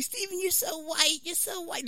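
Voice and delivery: high, soft imitation of accusers; mock conviction. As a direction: pitch it up and keep it hushed. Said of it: "they say it with such conviction".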